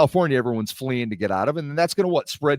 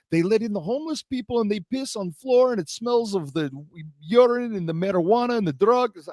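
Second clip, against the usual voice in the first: higher-pitched imitation of an Armenian friend; Armenian accent; 7 semitones higher than usual. Armenian buddy back from San Francisco, appalled, Armenian accent. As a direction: complain in a put-on Armenian accent. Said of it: "my Armenian friend".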